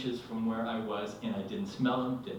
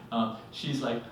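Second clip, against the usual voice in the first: usual speaking voice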